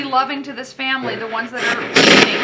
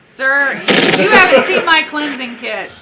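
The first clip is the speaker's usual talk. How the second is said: louder, laughing mock warning; playful threat; 6 dB louder, slower than usual. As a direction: tease the spirits with a threat. Mock threatens spirits with cleansing kit.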